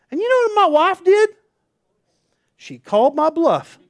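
spring the punchline with glee; high, loud, delighted; excited punchline. Real, not acted. Punchline of wife calling bluff.

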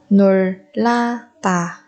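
놀라다 slowly, each syllable apart. Shown as a demonstration. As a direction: break 놀라다 into drawn-out syllables. Teacher models word syllable by syllable.

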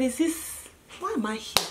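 soft, disbelieving murmur; hushed self-questioning. Retold or imitated. Asking self after being caught, hushed disbelief. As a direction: ask yourself under your breath.

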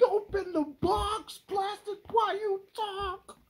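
mocking an impatient fan in a high whine. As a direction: whine like a pushy viewer demanding the unboxing. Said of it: "Everybody's like".

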